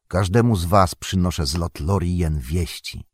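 plain reading voice

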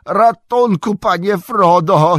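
high, loud, drawn-out cry for help; slow, panicked high wail; moaned plea. Acted. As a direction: wail it like someone drowning. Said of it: "jęknął sam".

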